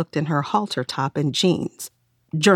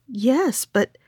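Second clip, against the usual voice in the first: stammering, higher voice; 7 semitones higher than usual. Acted. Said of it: "she stammered".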